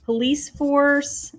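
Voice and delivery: ordinary talk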